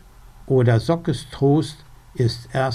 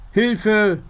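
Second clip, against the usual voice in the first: high, louder cry for help; calling for help; 8 semitones higher, 3 dB louder than usual. Acted. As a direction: call out for help like a frantic little raven. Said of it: "ruft er".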